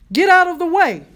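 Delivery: loud, high shout to clear out; shouted warning. A narrator's reading. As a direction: yell an urgent warning. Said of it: "he shouted".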